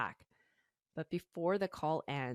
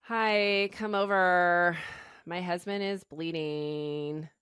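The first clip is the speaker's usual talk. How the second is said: put-on loud, slow, drawn-out vocal-fry valley girl; drunk Kardashian impression; 3 semitones higher, 9 dB louder, slower than usual. Host imitates Rebecca's unpanicked 911 call, loud, slow Kardashian drawl. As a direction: drawl it like a bored, tipsy Kardashian. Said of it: "this is what she sounds like".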